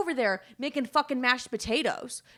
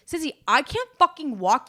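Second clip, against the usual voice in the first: retold angry yell; 6 semitones higher, 7 dB louder than usual. Retold fight with sister.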